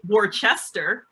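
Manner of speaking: "Worcester" said as war-CHESS-ter; mistaken pronunciation. Retold or imitated. Wrestler quoting people who add an H, "Worcester" as war-CHESTER. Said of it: "There's no H".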